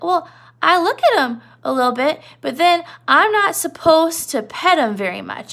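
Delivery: put-on a big kid whining innocently; Lenny. Teacher voicing Lenny explaining why he came, high and childlike. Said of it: "And Lenny was like".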